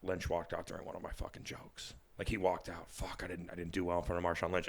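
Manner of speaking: low, quiet retold self-reproach; softly recounted disappointment; inner dejection. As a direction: mutter your sinking thoughts to yourself. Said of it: "in my head".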